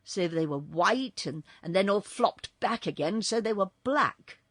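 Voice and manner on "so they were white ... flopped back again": plain reading voice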